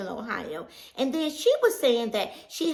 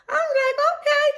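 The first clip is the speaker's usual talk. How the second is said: much higher, louder okay; startled acceptance; surprised okay; 6 dB louder than usual. Retold or imitated. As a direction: squeak out a stunned okay. Surprised retold reply.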